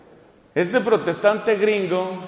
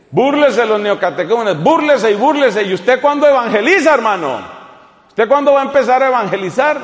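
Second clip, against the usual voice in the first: louder, higher sermon rebuke; fiery preaching; 3 semitones higher, 5 dB louder than usual. Acted. Priest scolding his congregation for mocking others, fiery preaching. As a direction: challenge the congregation, building to a roar.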